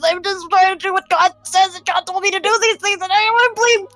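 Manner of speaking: high, fast, tumbling sob-talk; hammed-up wail up to the heavens. Nyla wails to Cordelia about her date, high and fast. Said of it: "Ugly crying starts. Wails".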